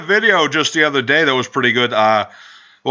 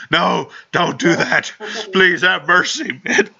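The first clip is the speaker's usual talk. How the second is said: slow, higher-pitched begging; mock pleading; 6 semitones higher, slower than usual. Victim begging Shaggy for mercy, mock fear. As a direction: plead for mercy in a put-on whimper.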